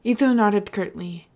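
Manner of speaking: plain reading voice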